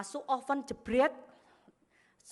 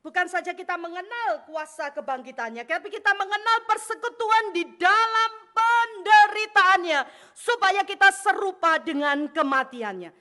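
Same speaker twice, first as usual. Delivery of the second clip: high, loud, stretched-out exhortation; emphatic preaching; 8 semitones higher, 11 dB louder than usual. Real, not acted. Preacher expounds Philippians to congregation, emphatic. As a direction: drive home each phrase forcefully.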